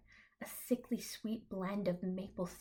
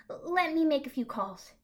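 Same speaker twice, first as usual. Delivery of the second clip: higher, louder flustered reply; stammering; 8 semitones higher, 7 dB louder than usual. Acted. President agreeing under pressure, high and stammering. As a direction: hurry the words out nervously. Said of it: "he stammers".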